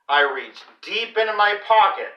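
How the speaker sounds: usual speaking voice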